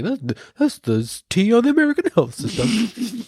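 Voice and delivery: high, sassy spill-the-tea voice; high sassy quote. Retold or imitated. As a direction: dish it out like gossip. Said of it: "I was like".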